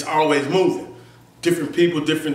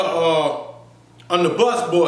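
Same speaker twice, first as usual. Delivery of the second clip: normal talking voice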